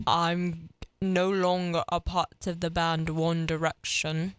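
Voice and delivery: slow, low, mock British accent; drawn-out put-on British drawl. Host impersonating a former band singer, British accent. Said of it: "this is [name]".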